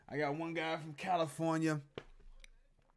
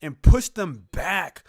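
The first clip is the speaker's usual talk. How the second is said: excited; 3 semitones higher, 11 dB louder than usual. Real, not acted. Excited reaction to big play.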